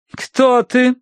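high, cold demand; холодный вопрос. A narrator's reading. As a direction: question the spirit coldly as a medium. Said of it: "холодно вопросила мадам Иритоцкая". (холодно, высоким голосом)

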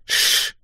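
voiceless "shh" hiss; shush. A narrator's reading. Steve cuts off Manda's question, hissed shush. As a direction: shush them quickly. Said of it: "сказал Стив".

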